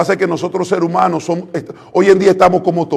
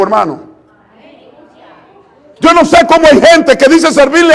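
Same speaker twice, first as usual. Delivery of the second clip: raised, louder preaching, pushing each line higher; preaching at full force; 6 semitones higher, 5 dB louder than usual. Acted. Preacher urging the congregation, raised and fervent. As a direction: preach it out with rising fervour.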